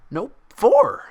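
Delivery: high, loud, bouncy certainty; certain Pinkie voice. Acted. Pinkie insisting to Twilight, high and loud. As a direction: chirp it with total conviction. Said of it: "declared with absolute certainty".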